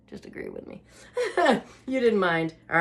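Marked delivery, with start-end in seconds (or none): 0.1-0.8 conspiratorial whisper; whispered aside. Real, not acted. Whispering to viewers to just agree, sly whisper.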